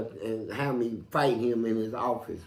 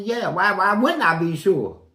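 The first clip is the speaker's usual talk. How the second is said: loud, higher indignant retort; 5 semitones higher, 9 dB louder than usual. Retold or imitated. Answering the psychiatrist, loud and indignant. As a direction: bristle at the question.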